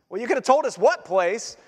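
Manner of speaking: normal talking voice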